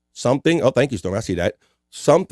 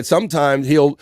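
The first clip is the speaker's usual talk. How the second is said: usual speaking voice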